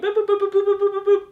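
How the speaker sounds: rapid high boop noises; high copying beeps. Retold or imitated. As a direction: beep like a copy machine. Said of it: "copied everything".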